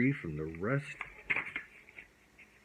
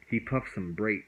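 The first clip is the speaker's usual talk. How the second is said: plain reading voice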